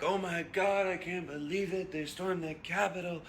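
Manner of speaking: high, gasping fake disbelief; mock shocked onlooker. Mocking people who gasp at the Capitol storming, high fake shock. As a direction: play the clueless gawker clutching pearls. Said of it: "and you're like".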